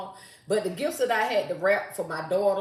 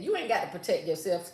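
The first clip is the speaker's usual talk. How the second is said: normal talking voice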